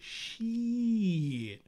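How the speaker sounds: imitating a TV dude dragging one word way out; slow mock drawl on the cuss word; drawn-out TV-character cussing. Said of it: "the dude was like, uh, he, he said".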